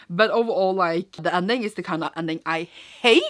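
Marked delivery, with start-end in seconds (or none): 2.4-3.2 "hate" drawn out with heavy emphasis; stress on HATE. Real, not acted. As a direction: lean hard and long on the word "hate". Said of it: "Emphasis on the word hate".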